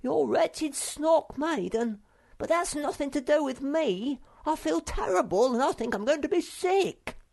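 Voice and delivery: peevish little creature complaining he feels ill; whiny Sniff. A narrator's reading. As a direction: drag it out like a sulking sick child. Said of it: "interrupted Sniff".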